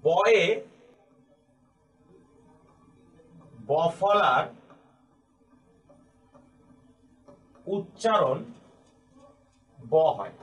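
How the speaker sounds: each word set apart by long pauses; dictated word by word. Shown as a demonstration. Teacher dictating the rule to students, word by word. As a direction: spell the rule out slowly as if writing it on the board. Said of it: "এই নিয়মটিকে আমি এভাবে বলতে পারি".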